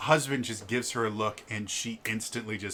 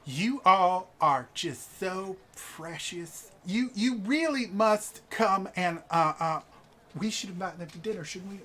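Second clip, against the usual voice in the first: gushing noblewoman; 8 semitones higher than usual. Acted. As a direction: invite them eagerly. GM voices a gushing wife.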